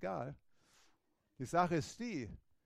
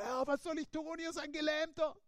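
higher, helpless hammed-up plea; mock panic; 12 semitones higher than usual. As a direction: Peter pretending to panic. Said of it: "dass er geschrien hat".

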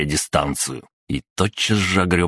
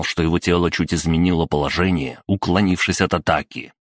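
usual speaking voice

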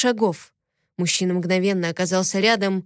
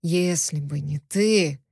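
slow, low, sinister male voice, stressing ты; ominous; 4 semitones lower, slower than usual. A narrator's reading. Prisoner throws her words back, ominously. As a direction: drag it out darkly, landing on ты. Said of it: "зловеще произнёс пленник".

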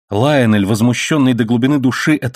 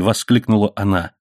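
plain reading voice

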